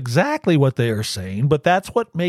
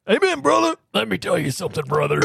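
higher gravel wrestler promo; Macho Man impression; 4 semitones higher, faster than usual. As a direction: Macho Man Randy Savage call. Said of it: "That's Macho Man".

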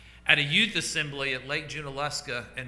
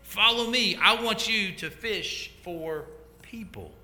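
raised, higher-pitched call to men at a distance; 6 semitones higher, slower than usual. Acted. Jesus calls out to Simon and Andrew, raised calling voice. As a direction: call them over, stretching the last words. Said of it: "He calls out to them".

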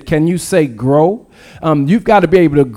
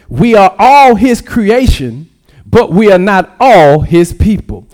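louder, stressing ALL and NOT; emphatic repeat; 4 dB louder than usual. Shown as a demonstration. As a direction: drive the point home, louder the second time. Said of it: "I'll say it one more time".

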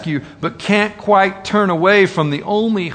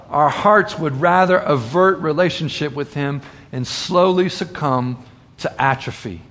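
normal talking voice

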